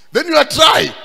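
high, loud, incredulous jab; mocking punchline. Real, not acted. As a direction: throw it out loudly as a punchline. Preacher's mocking punchline, then laughs.

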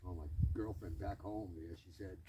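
ordinary delivery

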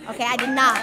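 loud, firm insistence on not; loud emphatic correction; emphatic denial. Real, not acted. Insisting she did not forget.

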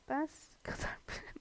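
whispered repeat breaking into a giggle; laughing under the breath; giggly whisper. Real, not acted. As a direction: whisper it again and crack up.

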